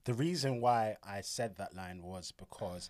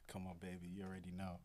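smooth and soft; 3 semitones lower, 12 dB quieter than usual. Pretend. Smooth low answer.

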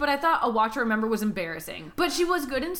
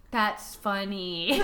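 "That's funny" drawn out slow, lower, no laugh; 5 semitones lower, much slower than usual. Retold or imitated. Imitating a sitcom character who never laughs, slow deadpan. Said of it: "always goes, "That's funny," instead of laughing".

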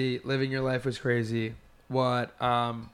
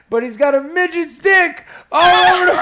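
very high, loud shout into laughter; hysterical punchline; 12 dB louder than usual. Retold or imitated. Imitating comedian's final reveal to his boys, hysterical laughing shout.

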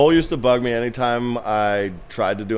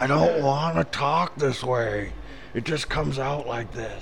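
Little Nicky voice; 2 semitones higher, 5 dB quieter, slower than usual. Pretend. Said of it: "sounds like Little Nicky".

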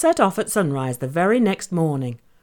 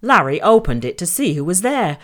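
ordinary delivery

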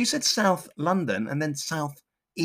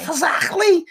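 mock disbelief. Mocking her place name.

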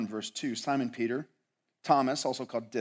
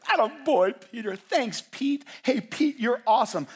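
mock-enthusiastic praise; high-pitched, gushing attaboy, said in irony; Jesus; 11 semitones higher, 5 dB louder than usual. Preacher imagines Jesus congratulating Peter for the sword, mock praise. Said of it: "what does Jesus go?".

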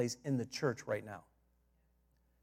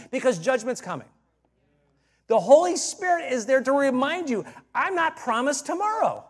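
louder and higher, preaching at full force; fiery preaching; 7 semitones higher, 4 dB louder than usual. Acted. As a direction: lift it up and drive the point home to the church.

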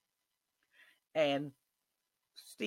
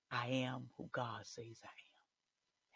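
soft, slow whisper; slow closing whisper; 4 semitones lower, 9 dB quieter, slower than usual. Real, not acted. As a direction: breathe the last words slowly. Closing affirmation to listeners.

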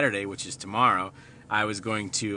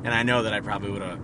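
ordinary talk

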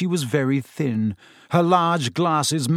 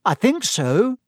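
higher, nervous small reply; tremulous; 8 semitones higher, slower than usual. A narrator's reading. Frightened boy answers the seer, higher and tremulous. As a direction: answer with a shaky nerve. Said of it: "said Neville tremulously".

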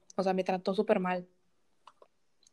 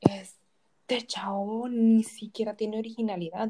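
whispered, slow and scornful; 3 dB louder, slower than usual. Retold or imitated. Telling her friend what she thought of her ex, whispered scorn. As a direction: breathe it out in slow disgust. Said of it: "dije yo".

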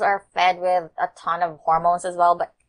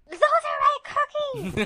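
high, shrill possessive yell; angry shout. Pretend. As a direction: snap like a kid defending snacks. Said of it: "I'd be like".

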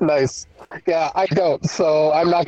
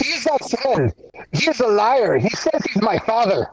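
higher-pitched, bad Russian accent; crappy Russian accent; 5 semitones higher than usual. Gertie denying the stranger is her father, in a crappy Russian accent. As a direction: protest in a rough Russian accent. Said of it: "The Russian accent".

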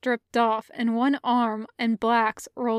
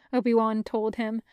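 plain reading voice

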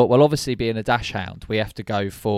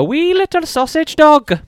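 high, loud mock-Irish lilt; dodgy Irish accent; 8 dB louder than usual. Host announces the first dog breed in a dodgy Irish accent. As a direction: put on a badly faked Irish accent, pitched way up. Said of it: "I don't know what that accent was".

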